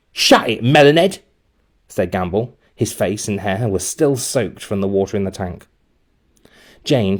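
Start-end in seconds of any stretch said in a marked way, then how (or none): 0.1-1.2 louder taunting bully; rude snap. A narrator's reading. Gamble insulting Jane, loud and rude. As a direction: brush her off with a sneer.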